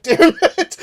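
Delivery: high, loud mock defeat; frustrated yell. John losing the pizza argument, loud mock frustration. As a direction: cry out like you lost the bet. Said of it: "John just went like".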